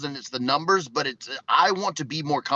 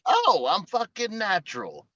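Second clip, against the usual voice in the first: mock-cocky, slowed and pitched way up; cocky self-quote; 8 semitones higher, slower than usual. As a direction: ham up your younger self's swagger. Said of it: "And so I was like".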